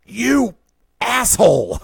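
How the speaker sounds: high, loud snapped insult; angry outburst. Retold or imitated. Dad finally losing it at his teenage daughter, yelling. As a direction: let it rip at last. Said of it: "my dad finally turned to my sister and said".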